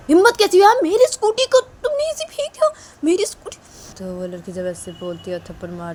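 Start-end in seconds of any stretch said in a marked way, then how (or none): 0.1-3.6 very high, loud furious shout. Acted. New girl confronts the bully after slapping her, very high furious shouting. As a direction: scream at her for throwing it. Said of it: "बोलती कि".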